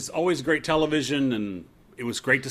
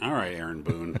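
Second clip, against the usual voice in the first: ordinary talk, nothing marked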